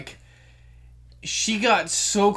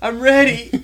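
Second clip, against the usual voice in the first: higher, louder mock eagerness; eager retelling; 5 semitones higher, 7 dB louder than usual. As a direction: act out her excited readiness. Retells excited woman before zipline jump.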